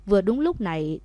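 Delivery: ordinary delivery, nothing marked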